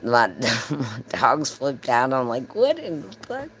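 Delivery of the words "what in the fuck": high-pitched, startled exclamation. Retold or imitated. In the shower as the pets flip out, startled. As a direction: blurt it in alarmed disbelief.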